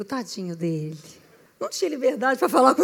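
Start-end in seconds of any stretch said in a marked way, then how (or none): 0.0-1.0 soft low pity; pitying aside. Retold or imitated. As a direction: murmur it to yourself, pitying. Pitying thought.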